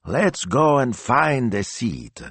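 high, slow coaxing lady; doting Italian churchgoer; bossy signora. A narrator's reading. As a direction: lead him along with a high drawl. Signora leads priest away.

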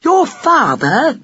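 slow, high-pitched astonished question; astonishment. A narrator's reading. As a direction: stretch it out in disbelief. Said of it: "said Buck in astonishment".

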